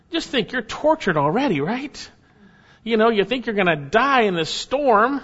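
usual speaking voice

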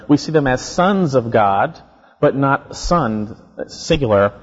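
ordinary talk, nothing marked

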